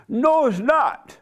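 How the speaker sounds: high, slow, emphatic contradiction; loud defiant woman. Retold or imitated. Customer contradicting the manager about the law, loud imitated defiance. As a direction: throw it back loudly, drawing it out. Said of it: "'cause she's so loud".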